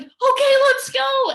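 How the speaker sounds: new recruit fired up, ready to go; eager yes. Retold or imitated. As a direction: jump at it with total enthusiasm. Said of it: "me knowing nothing was like".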